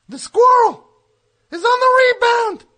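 boastful shout. Acted. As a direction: proclaim it loudly. [name] boasting.

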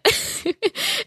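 high burst of disbelieving laughter; a real laugh of disbelief; incredulous laugh. Real, not acted. Laughs at vote-buying offer.

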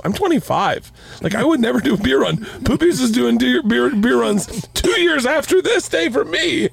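high-pitched imitation of an incredulous young assistant; amused disbelief. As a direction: squeal it in rising mock disbelief. Giggling assistant's amazed outburst, acted out.

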